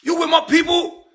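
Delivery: high stunned yelling; shouting. Retold or imitated. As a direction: shout it at the phone. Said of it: "I'm like".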